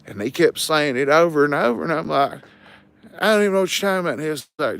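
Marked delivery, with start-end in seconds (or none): 3.2-4.1 high-pitched retold protest; baffled protest. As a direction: act out your bewildered comeback. Retelling his baffled reply in quarrel.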